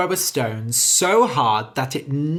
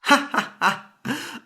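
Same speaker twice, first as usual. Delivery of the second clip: laughing; 9 semitones higher, slower than usual. A narrator's reading. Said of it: "and laughed".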